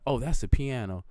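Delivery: ordinary delivery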